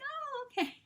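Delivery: very high, polite confusion; bemused shop lady. Retold or imitated. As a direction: humor her politely. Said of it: "they're like".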